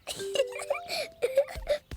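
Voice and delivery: laughing and crying at once. Real, not acted. [name] laughs and cries at once, laughing and crying.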